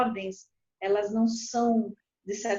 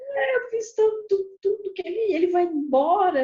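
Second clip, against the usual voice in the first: much higher, aggrieved complaint; complaining wife; 8 semitones higher, 8 dB louder than usual. Retold or imitated. The wronged wife complains her husband left, in a high aggrieved voice. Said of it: "A pessoa fala".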